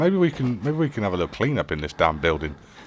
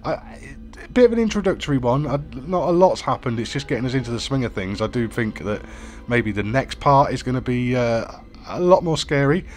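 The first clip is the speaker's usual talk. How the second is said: YouTube outro; 4 semitones higher than usual. Acted. Outro sign-off to viewers.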